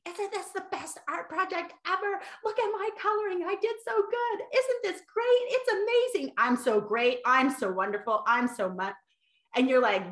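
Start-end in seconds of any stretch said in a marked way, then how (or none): 0.0-8.9 put-on high, bright child voice bragging about a drawing; proud toddler. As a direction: play a two-year-old showing off art. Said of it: "when they come home with their art project".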